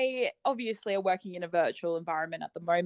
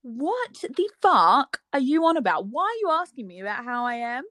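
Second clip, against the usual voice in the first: baffled look voiced; 4 semitones higher, 4 dB louder than usual. Australian voicing Brits' puzzled faces at her reply, mock bafflement. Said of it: "faces just look at you like".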